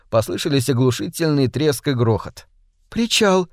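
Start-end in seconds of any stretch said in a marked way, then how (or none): 2.9-3.5 sudden high cry of alarm; exclaiming. A narrator's reading. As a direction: blurt it out, startled. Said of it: "воскликнула Кэнди".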